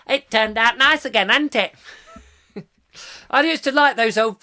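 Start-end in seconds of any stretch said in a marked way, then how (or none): 0.1-1.7 high, loud comic catchphrase voice; hammed-up music-hall comedian. Host imitating an old film comedian, high cheeky voice. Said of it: "the old [name] films".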